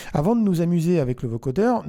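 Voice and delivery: ordinary talk, nothing marked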